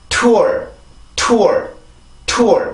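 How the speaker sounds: tour with a strong aspirated T, falling, r at the end; English T. Shown as a demonstration. Teacher drilling learners on the word, English T. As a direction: hit tour as one stressed T-OOR. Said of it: "다시 한번".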